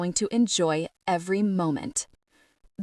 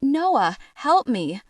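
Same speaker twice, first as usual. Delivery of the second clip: cry for help; 5 semitones higher, 5 dB louder, slower than usual. Acted. Dragged back, calling for help.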